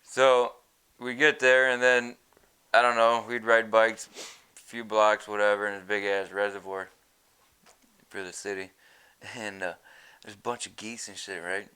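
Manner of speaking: ordinary talk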